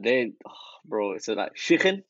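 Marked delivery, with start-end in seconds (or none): 1.5-2.0 high-pitched mock Scouse; Liverpool accent. Mimicking how Liverpool people speak to the friends, mock Scouse accent. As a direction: put on a thick Liverpool accent. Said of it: "that's how they speak".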